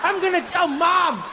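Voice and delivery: high-pitched mock child tantrum; outraged kid. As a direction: accuse in a high, put-on kid's voice. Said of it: "this kid was like".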